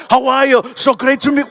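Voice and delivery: effusive Austrian star welcoming a fan; Arnold Schwarzenegger. Retold or imitated. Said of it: "and he's like".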